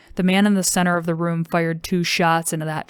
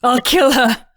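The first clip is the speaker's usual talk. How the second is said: high, loud enraged cry; furious threat; 7 semitones higher, 9 dB louder than usual. Acted. Mother raging at her husband, furious and loud. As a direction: spit the threat out in fury. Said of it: "hissing between her teeth".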